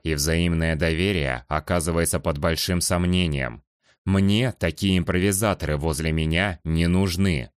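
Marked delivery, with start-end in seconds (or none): none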